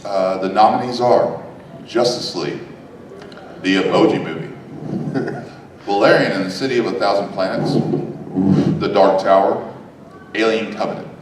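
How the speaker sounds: suspenseful awards presenter. Acted. Reading award nominees.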